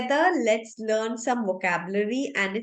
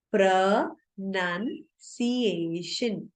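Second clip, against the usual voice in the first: Pronunciation split as pruh, nun, see-ay-shun; broken into syllables. Shown as a demonstration. Teacher breaking the word up so learners understand, syllable by syllable. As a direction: say pronunciation in pieces, pausing between them. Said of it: "word को break करके बोल रही हूँ".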